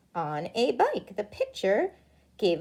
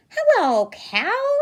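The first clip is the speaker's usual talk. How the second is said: cheery duck greeting; 11 semitones higher, 3 dB louder, slower than usual. Acted. Duck greets farm animals.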